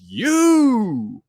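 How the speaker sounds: high, loud, drawn-out mock shock; dramatic exclamation. Steve recognises the unseen visitor, hammed-up shocked cry. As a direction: cry it out long and theatrically.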